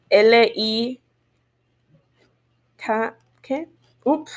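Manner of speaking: ordinary talk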